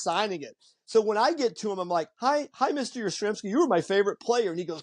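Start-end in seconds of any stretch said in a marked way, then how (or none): 2.2-4.5 higher-pitched, eager fan greeting; starstruck fan. Retold or imitated. Fan reaching his favorite player in line, starstruck. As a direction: gush politely at your idol, lightly acted. Teller meets his idol for autograph.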